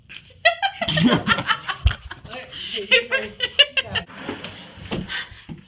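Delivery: high, loud peals of laughter; long shrieking laugh; loud laughing fit. Real, not acted. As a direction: crack up uncontrollably. Cracking up in a blooper.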